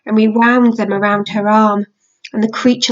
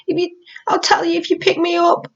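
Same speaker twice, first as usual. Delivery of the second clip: tearful bargaining; 7 semitones higher than usual. Acted. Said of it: "His eyes filled with tears".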